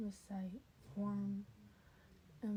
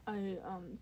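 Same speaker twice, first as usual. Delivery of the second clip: ordinary delivery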